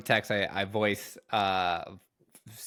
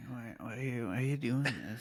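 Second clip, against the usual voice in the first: quiet mock hurt; 7 dB quieter than usual.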